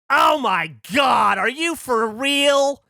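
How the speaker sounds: drawn-out, high, loud outraged cry; angry older lady. Retold or imitated. Lady next to him at the proposal, angrily outraged. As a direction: stretch each word into an indignant shout. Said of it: "Like, angry".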